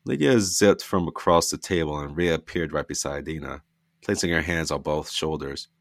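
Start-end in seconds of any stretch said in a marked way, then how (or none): none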